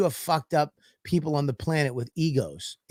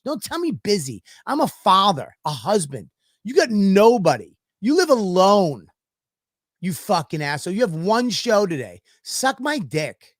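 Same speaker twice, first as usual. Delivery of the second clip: higher, louder angry tirade at the rude comic; heated rant; 5 semitones higher, 4 dB louder than usual. Retold or imitated. Scene: comedian ranting at a rude fellow comic, angry and louder. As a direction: rant it, building heat.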